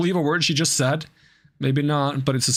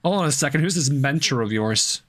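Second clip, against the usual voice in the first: usual speaking voice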